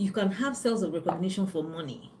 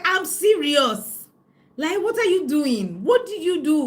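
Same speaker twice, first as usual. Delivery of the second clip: high, loud, slow insisting; mock friend's loud insistence; 9 semitones higher, 9 dB louder, slower than usual. Imitating her friend demanding what she did, loud and high. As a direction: lean in and demand an answer. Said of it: "she was like".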